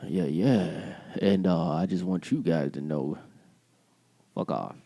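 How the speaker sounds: low mock radio host, dropping at the end; smooth on-air patter sinking very low; put-on radio voice. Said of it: "This is our radio voice".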